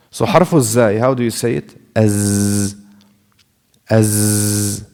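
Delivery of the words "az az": "Az." buzzed with voice, no breath; voiced z demo. Shown as a demonstration. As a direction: hold the voiced z buzz. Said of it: "It's not about breath anymore".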